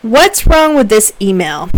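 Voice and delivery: ordinary delivery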